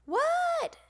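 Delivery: high loud answer; loud, high-pitched reply; calling back. A narrator's reading. Froggy answers Grandma's call.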